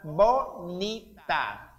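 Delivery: slow "bonita", a pause between syllables; teacher separating "bonita" syllable by syllable; split into syllables. Shown as a demonstration. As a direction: sound out "bonita" as bo, ni, ta. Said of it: "quando a palavra tem mais sílabas".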